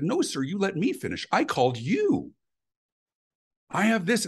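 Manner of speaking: high-pitched, exasperated customer pushing back; indignant retort. Retold or imitated. Caller to agent who wants to finish first, indignant retort. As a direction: re-enact an indignant caller refusing to yield. Retold quarrel with phone agent.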